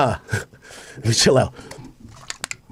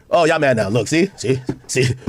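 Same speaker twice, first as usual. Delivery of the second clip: low mocking voice; 12 semitones lower than usual.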